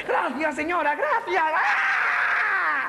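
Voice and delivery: high, drawn-out shout; calling out. Acted. Star greeting the amphitheatre from a helicopter, high calling. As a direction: call up over a helicopter's noise.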